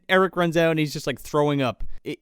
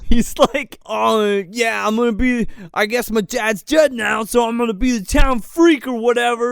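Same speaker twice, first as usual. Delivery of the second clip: higher, hammed-up self-pitying whine; mock whimpering tirade; 4 semitones higher, 4 dB louder than usual. Host mocking character's tirade about dead dad, mock whimpering. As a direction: imitate a sulky kid whimpering through a rant. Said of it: "goes on this whimpering tirade".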